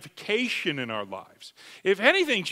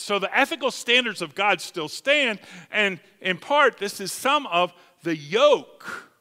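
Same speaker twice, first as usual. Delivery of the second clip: normal talking voice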